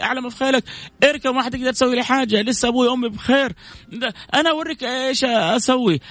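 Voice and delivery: high, heated shouting match; acted marital fight. Husband and wife trading threats, she packs her bag, heated and high-pitched.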